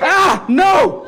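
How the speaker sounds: high, loud cry of dismay; panicked shout. Acted. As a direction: yell out in alarm. Attacked by robot.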